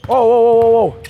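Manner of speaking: loud whoa; calling stop. Real, not acted. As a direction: halt the drill with a shout. Coach stops play mid-drill.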